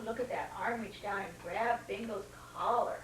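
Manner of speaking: plain reading voice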